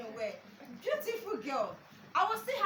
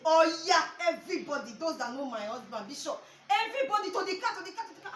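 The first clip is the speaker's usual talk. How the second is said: loud, excited mock commands, rising higher; imitated panicked shouting; 4 semitones higher, 10 dB louder than usual. Husband rushing everybody to the car, loud panicked imitation.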